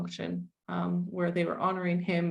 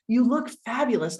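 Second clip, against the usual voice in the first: gushing; 4 semitones higher, 7 dB louder than usual. Retold or imitated. People praising her weight loss.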